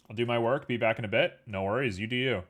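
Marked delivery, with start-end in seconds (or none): none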